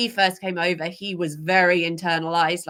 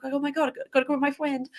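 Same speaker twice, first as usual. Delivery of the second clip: voicing the horse; 7 semitones higher, 3 dB quieter, much faster than usual. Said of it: "be like".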